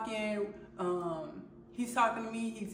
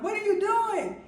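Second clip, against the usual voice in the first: much higher, louder startled yell; alarmed shout; 12 semitones higher, 6 dB louder than usual. Retold or imitated. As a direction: snap it out in alarm. Dad pushes her away.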